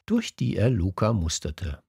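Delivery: ordinary delivery, nothing marked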